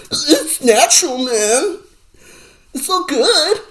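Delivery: high, dreamy mock stoner voice; mock stoner hippie. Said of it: "I make fun of that culture".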